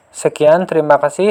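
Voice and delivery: ordinary talk, nothing marked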